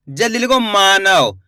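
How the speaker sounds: usual speaking voice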